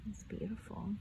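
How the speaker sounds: hushed whisper of awe. Acted. Reader marvelling at the moonlit snow, whispered. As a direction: breathe it as if not to wake the woods. Moonlit clearing, awed aside.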